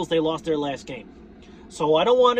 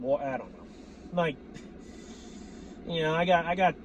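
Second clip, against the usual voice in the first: normal talking voice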